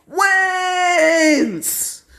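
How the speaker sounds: high, slow hammed-up announcer call; crowning the winner, high announcer yell. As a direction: belt it like a ringside announcer. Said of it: "Prison Mike is like".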